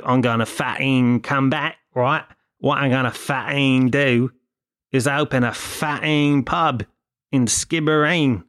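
mock London accent with dropped consonants. Imitating the uncle back from London, mock London accent. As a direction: put on an exaggerated London accent, slowing at the end. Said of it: "got a fucking London accent".

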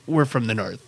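low-pitched, understated retort; amused deadpan correction. Retold or imitated. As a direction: drop the pitch and correct them gently. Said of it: "he just smiles, shakes his head slowly".

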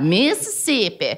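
put-on Southern twang; Southern accent. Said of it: "we do the accent".

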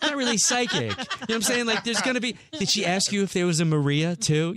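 high-pitched, laughing disbelief; incredulous mockery. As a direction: scoff at grandma's psychic, pitch shooting up. Mocks the psychic to grandmother.

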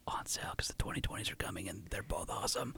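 whispered aside, hushed and secretive; whispering. Real, not acted. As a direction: whisper it like a guilty confession. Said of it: "Why are you whispering that?".

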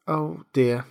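understated sorrow; a small, dismayed lament; sorrowful tone. A narrator's reading. Alice sees she has upset the mouse, mildly sorrowful. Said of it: "cried Alice in a sorrowful tone".

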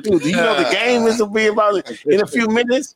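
high-pitched incredulous retelling; mock disbelief. Teammate baffled by half-dressed player before tip-off, mock disbelief.

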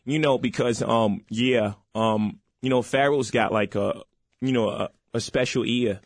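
halting, punchy rapper talk with fillers. Acted. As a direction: do the rap mogul, loud and measured. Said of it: "When you do [name], it's just, it's spot on".

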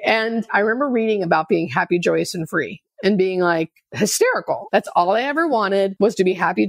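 usual speaking voice

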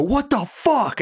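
high, alarmed mock yell; imitating a shocked shout; startled yell. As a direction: bark it at the intruder. Stranger opens hotel door.

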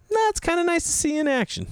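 very high teasing voice; hammed-up gleeful teasing; amused friend Nate. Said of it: "And he goes".

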